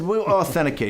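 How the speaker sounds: a lightly hammed-up big shot promising too much; mock big shot. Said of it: "I'm like this big shot".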